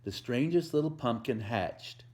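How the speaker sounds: lively picture-book read-aloud with a bouncy rhythm; reading to children. A narrator's reading. Volunteer reading a Halloween book to kids, storytelling voice.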